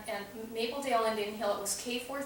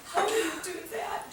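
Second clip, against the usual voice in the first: put-on higher, amazed small child; first grader amazed by the question; little boy; 8 semitones higher than usual. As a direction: squeak it like a wide-eyed kid.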